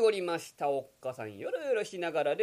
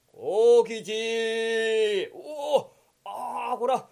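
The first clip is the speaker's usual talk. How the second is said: startled; 4 semitones higher, 6 dB louder, much slower than usual. Acted. Kokichi startled by yokozuna.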